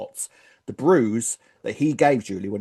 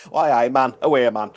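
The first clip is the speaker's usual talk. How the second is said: loud mock Geordie; hammed-up Newcastle Geordie; Geordie accent; 5 dB louder than usual. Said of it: "Ree's from Newcastle".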